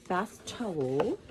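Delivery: ordinary talk, nothing marked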